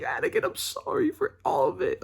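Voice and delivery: imitated crying in a very high voice; high, squeaky tearful apology; Obi-Wan. Fan retelling Obi-Wan's apology to Anakin, imitated crying. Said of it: "he's, like, crying".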